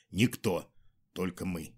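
Никто soft and low, falling; quiet emphasis. A narrator's reading. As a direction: drop to a hush on Никто. Repeat quiet.

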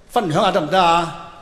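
ordinary talk, nothing marked

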